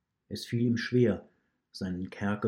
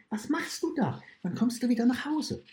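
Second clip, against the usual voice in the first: put-on small child's voice, much higher and hushed; hushed wolf cub. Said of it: "flüsterte der Wolfssohn". (leise, hohe Kinderstimme eines Wolfsjungen)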